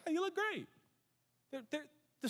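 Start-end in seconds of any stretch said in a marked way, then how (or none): 0.1-0.7 high-pitched mock compliment; admiring friend. Preacher voicing friends praising a workout, mock-admiring. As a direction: gush lightly, pitched way up. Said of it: "your friends will probably be like".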